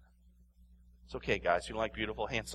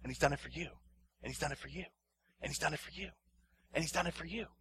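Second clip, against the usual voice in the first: fading to soft; 9 dB quieter, much faster than usual. Real, not acted. Preacher repeating the refrain to the congregation, fading soft. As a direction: repeat it softer and softer. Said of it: "repeated refrain fading".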